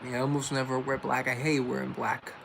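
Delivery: ordinary delivery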